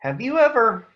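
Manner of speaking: usual speaking voice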